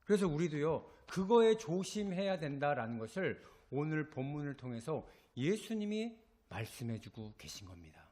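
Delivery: usual speaking voice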